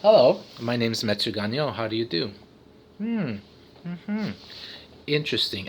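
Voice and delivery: low, restrained formal introduction; mock-serious, low professional voice; professional manner. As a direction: act composed and businesslike, low and soft. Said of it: "see me in a professional context".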